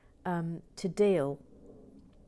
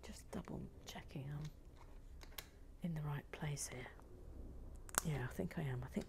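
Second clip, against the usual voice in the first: whisper; 3 semitones lower, 14 dB quieter than usual. Real, not acted. Whispered check.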